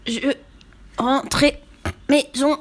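high, strangled word by word; feeble articulation. A narrator's reading. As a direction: force out each word while being squeezed. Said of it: "articula Lou faiblement".

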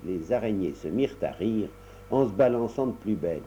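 ordinary delivery, nothing marked

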